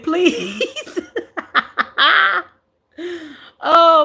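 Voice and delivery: laughed out please; please through a giggle; cracking up on please; laughing. Real, not acted. Laughing at chat.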